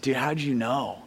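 low, soft question, lightly acted; imitating tearful friend. Friend on the phone after hearing the prayer, subdued. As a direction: ask it quietly, a touch of being moved. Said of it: "he's crying".

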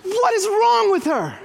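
high, loud alarmed dad; a panicked father's inner cry; loud, high, half-joking alarm; alarmed disbelief. Retold or imitated.

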